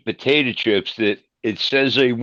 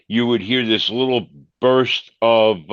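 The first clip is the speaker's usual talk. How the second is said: ordinary talk, nothing marked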